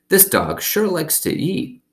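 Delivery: put-on old woman's voice. A narrator's reading.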